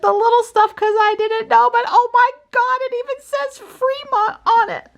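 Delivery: very high, gushing excitement; excited high voice. Real, not acted. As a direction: gush about it in a high voice. Gushing over toy car set.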